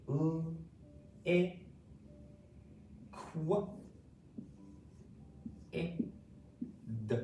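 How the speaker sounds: L, I, Q, I, D with long pauses between sounds; sounded out. Shown as a demonstration. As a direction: isolate each sound, leaving a big gap. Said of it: "saying these sounds and words".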